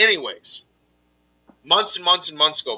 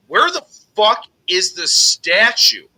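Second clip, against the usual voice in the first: higher, louder, slow furious question; angry demand; 5 semitones higher, 6 dB louder, slower than usual. Retold or imitated. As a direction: demand it slowly, fed up. Demanding the statue from the maker.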